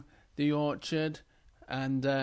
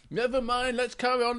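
forgiving Big Bear; 8 semitones higher than usual. Acted.

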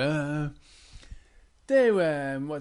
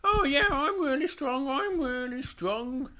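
boasting Little Bear; 9 semitones higher than usual. Acted.

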